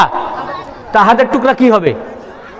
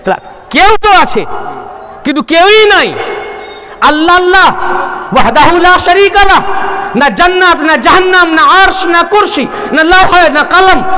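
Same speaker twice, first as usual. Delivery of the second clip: raised, ringing sermon voice, driving each line; fervent preaching; 5 semitones higher than usual. Acted. Preacher declaring God's oneness to the congregation, fervent and raised. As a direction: preach it out to the gathering, building fervour. Preacher rises to full force.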